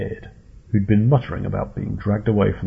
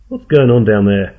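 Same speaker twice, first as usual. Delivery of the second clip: louder, calling down into the dark; a man yelling from his deck; shouted; 8 dB louder than usual. A narrator's reading. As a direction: shout it down over the deck rail. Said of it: "shouted Jerry".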